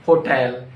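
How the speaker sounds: "hotel" as ho-TELL; corrected HOTEL. Shown as a demonstration. Teacher correcting learners, "hotel" said as ho-TELL. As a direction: say "hotel" with a full TELL ending. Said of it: "It's not hotel".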